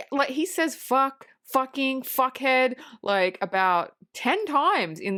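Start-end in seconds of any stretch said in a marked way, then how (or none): none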